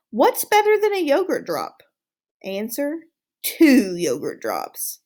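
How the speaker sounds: playful quiz reveal; quiz host. Acted. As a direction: stretch out two yogurt drops. Quiz-style question and answer.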